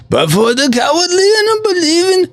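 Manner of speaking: high, hammed-up Cajun accent; put-on Cajun accent. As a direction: read scripture in a mock Cajun accent. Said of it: "spoken through the Cajun tongue".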